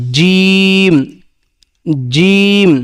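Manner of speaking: জিম with mid-tongue pressed to the palate, vowel held long; pressed Arabic jim. Shown as a demonstration. Teacher models জিম from the middle of the tongue, pressed and prolonged. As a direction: press the j of জিম firmly and stretch it. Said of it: "একটু চেপে ধরে".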